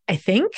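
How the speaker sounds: "think" swung up like a question; rising tone. Shown as a demonstration. Coach showing how an unsure introduction sounds, rising questioningly. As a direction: say "I think" as if asking. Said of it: "with that rising tone".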